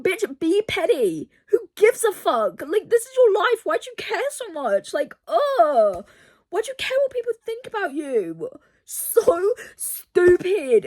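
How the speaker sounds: high-pitched, frustrated rant; exasperated. Real, not acted. Reader scolding a heroine over pride, exasperated. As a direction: wail your frustration, pitch way up. Arguing with heroine of hated book.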